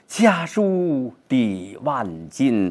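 缓慢朗诵; poetry recitation. Shown as a demonstration. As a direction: stretch each word, yearning. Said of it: "他这一种期盼".

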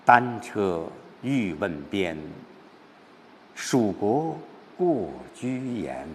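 slow poetic telling with long pauses; subdued, narrating recitation. Shown as a demonstration. Teacher models telling everyone the situation, subdued recitation. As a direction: lay out the lonely journey slowly and restrainedly. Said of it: "把情况交代给大家".

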